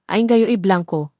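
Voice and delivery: ordinary delivery, nothing marked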